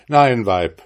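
loud, stern rebuke; angry. A narrator's reading. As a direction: cut her off in anger. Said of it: "rief jetzt zornig der Gesell".

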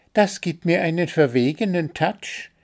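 high-pitched bird character; little bird voice. A narrator's reading. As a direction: answer high, as a small vain bird. Bird answers in read story. (hohe Vogelstimme)

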